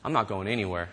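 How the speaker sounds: low, quiet refusal; understated mock defiance; addict refusing. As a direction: imitate a stubborn refusal, dropping down in pitch and volume. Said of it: "Sometimes the addict says".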